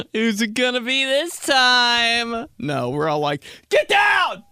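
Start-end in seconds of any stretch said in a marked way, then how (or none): 0.1-2.5 high, slow singsong excitement; mock delight. Imaginary family thrilled by the doorbell, mock delight. 3.7-4.4 high, loud alarmed yell; panicked shout. Acted. Family diving to hide from the doorbell, panicked shout.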